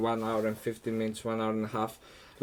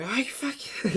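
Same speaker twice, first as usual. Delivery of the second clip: retold shout; 11 semitones higher, 5 dB quieter than usual. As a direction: imitate someone yelling in disbelief, pitched up. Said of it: "shouting at me".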